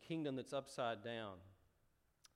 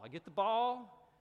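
louder, higher, slower, crowing over the catch; triumphant shout; 8 semitones higher, 9 dB louder than usual. Retold or imitated. Med student catching the baby, slow triumphant shout. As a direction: call it out like a quarterback who just caught it.

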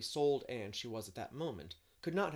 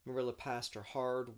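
ordinary delivery

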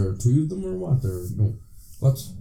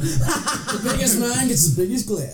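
mock proclamation; 9 semitones higher, slower than usual.

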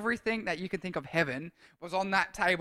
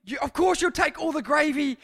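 higher, shouted scolding; mum scolding; 7 semitones higher, 4 dB louder than usual. Retold or imitated. Mum hounding [name] over the gravy: higher shouted scolding. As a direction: tell off a greedy son at the table. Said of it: "my mum was hounding me".

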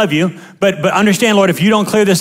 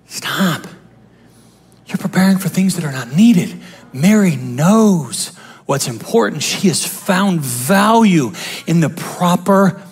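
much lower, soft and slow; Jesus speaking; 4 semitones lower, 4 dB quieter than usual. Acted. Jesus gently correcting Martha, low and soft. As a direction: say it low and tender, as the Lord.

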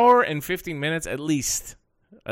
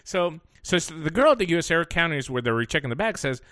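ordinary talk

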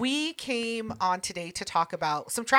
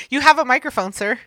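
ordinary delivery

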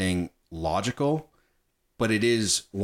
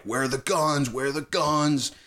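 higher-pitched insistent demand; mock threatening; 6 semitones higher than usual. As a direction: imitate a soldier interrogating a villager. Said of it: "threatening her".

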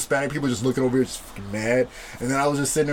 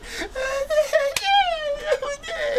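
long, very high mock squeal; imitated kid squeal; 3 dB quieter than usual. Little kid coming through the hallway, mock squeal.

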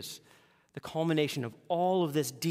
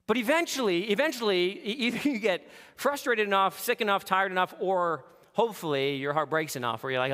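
normal talking voice

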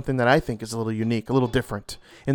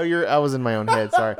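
ordinary talk

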